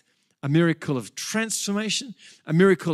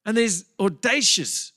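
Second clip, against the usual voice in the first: ordinary talk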